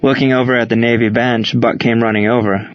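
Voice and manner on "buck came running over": plain reading voice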